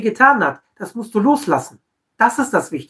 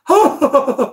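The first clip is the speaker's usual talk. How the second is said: high put-on chuckle; mock laugh; 6 semitones higher than usual. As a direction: fake a beaming laugh. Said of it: "Und dann so lachend". (hohes, gespieltes Lachen)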